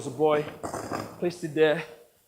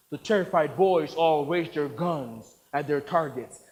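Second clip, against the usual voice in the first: ordinary talk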